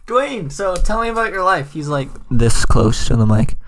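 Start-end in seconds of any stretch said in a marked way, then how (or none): 0.1-1.7 nervous interviewer. Pretend. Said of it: "interviewing The Rock".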